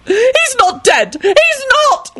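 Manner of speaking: very high, tearful screech; angry scream. A narrator's reading. Lady Fanshawe denies the death, screaming angrily. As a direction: screech the denial slowly. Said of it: "Lady Fanshawe screamed angrily".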